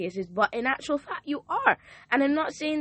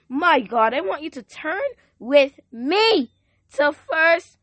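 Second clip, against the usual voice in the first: rising, louder preacher's call to the congregation; full-force preaching; 4 semitones higher, 5 dB louder than usual. Acted. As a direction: preach it out, lifting the room.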